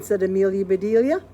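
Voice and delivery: plain reading voice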